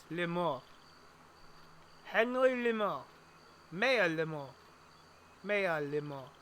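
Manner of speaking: French Canadian accent on the name. Shown as a demonstration. To himself, testing a name in French accent. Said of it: "I have a fairly good grasp of this accent".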